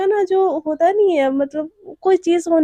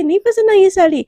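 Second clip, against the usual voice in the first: louder, higher burst of concern; sudden raised voice; 5 semitones higher, 6 dB louder than usual. Acted. Mother cuts in loudly for her daughter. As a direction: blurt it out loud, protective.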